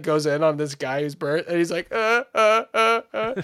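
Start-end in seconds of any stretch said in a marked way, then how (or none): 1.9-3.3 high, loud robotic grunts; imitated voice box. Burnt man answering through voice machine, mock robotic grunts. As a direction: buzz like an electrolarynx.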